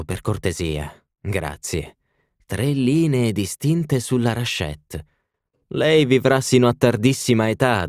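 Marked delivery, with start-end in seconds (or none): none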